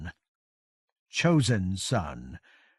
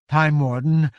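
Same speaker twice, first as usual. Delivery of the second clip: a weary giant's voice, higher and louder; giant's breathed greeting; 4 semitones higher, 6 dB louder than usual. A narrator's reading. Exhausted giant Coldspray greeting Covenant, hoarse and weary. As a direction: address him with worn-out gravity. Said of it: "Coldspray breathed".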